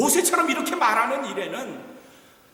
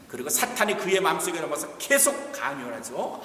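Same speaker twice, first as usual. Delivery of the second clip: ordinary delivery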